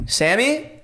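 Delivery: yelling a name. Retold or imitated. Roommate calls out through the house, mild yell. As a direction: call out for a friend you suspect. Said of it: "and I start yelling".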